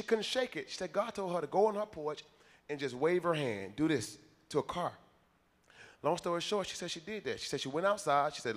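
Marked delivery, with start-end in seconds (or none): none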